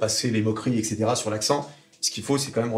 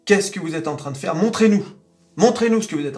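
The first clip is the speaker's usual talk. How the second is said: loud; 5 semitones higher, 5 dB louder, faster than usual. Acted. Voicing the market's demand.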